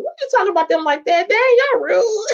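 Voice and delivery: high, quick mock-offended protest; playful indignation. Real, not acted. Host teasing viewers who mocked an accent, playfully indignant. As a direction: scold them playfully, pitch climbing. Scolding chat for mocking an accent.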